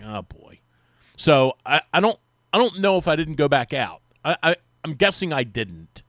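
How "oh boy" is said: dreading sigh. Retold or imitated. Retold dread at bad news.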